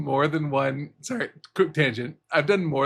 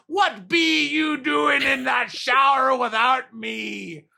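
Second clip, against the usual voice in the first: hammed-up British pirate accent, drifting; 6 semitones higher, slower than usual. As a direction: bellow like a peg-legged old British pirate. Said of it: "a pirate with a hat on and a peg leg walking in".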